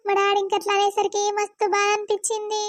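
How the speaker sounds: tearful worry rising into a high wail; weeping, anguished lament; crying. Acted. Daughter weeps over her parents' rejection.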